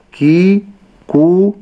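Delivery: كي and كو held too long and loud; wrong long kaf. Shown as a demonstration. Teacher imitates the mistake of lengthening the kaf. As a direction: drag out كي and كو. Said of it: "لا أقول".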